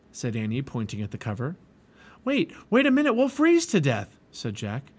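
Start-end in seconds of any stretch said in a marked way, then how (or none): 2.2-4.2 high, loud, panicky objection; alarmed protest. A narrator's reading. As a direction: cut in with alarm, pitched up.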